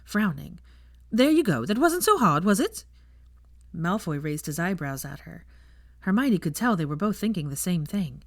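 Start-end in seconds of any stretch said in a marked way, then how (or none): 1.1-2.8 lightly voiced bubbly woman, higher and louder; bright cheeky teacher, pleased with herself; chirpy teasing witch. Cheerful teacher teasing two awkward guests, higher and louder.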